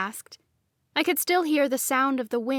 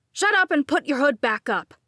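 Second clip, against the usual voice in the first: loud, high irritated scold; 5 semitones higher, 6 dB louder than usual. A narrator's reading. Grant scolds Lucas for breaking the act. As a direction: snap at him. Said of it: "Grant scolded".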